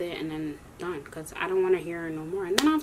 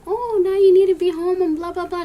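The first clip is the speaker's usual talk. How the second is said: mocking the nagging teacher; 9 semitones higher, 8 dB louder than usual.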